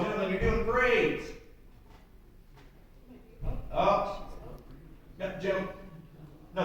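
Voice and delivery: ordinary talk, nothing marked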